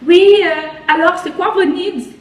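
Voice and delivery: high, lilting mock customer-service voice; Montreal franglais speaker. Imitating Montrealers who mix French and English, playful franglais. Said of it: "genre ils te parlent en franglais".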